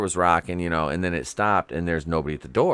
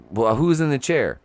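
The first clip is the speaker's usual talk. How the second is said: sharp, raised, louder demand; incredulous question; 8 semitones higher, 6 dB louder than usual. Retold or imitated. Son asking his sleeping family, incredulous and raised. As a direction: ask it in disbelief, pitched up high.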